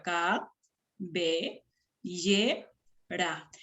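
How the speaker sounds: "cabellera" in four separate syllables with pauses; split into syllables. Shown as a demonstration. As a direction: say ca, be, lle, ra one at a time. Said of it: "Si la veo cabellera".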